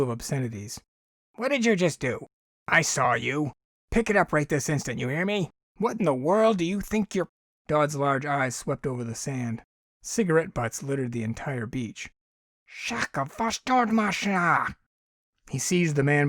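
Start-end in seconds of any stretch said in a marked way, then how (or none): 1.3-7.3 high, indignant scolding; angry scolding. Acted. Alien scolds litterer. 12.7-14.8 slow, high alien oath; alien curse. Acted.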